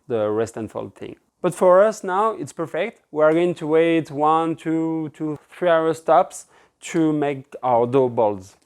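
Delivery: usual speaking voice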